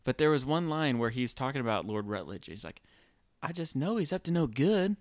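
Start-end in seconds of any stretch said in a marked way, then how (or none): 3.4-5.0 lightly Southern, higher-pitched movie quote; a hint of Southern twang, pitched up; imitated Southern accent. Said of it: "very Southern to me".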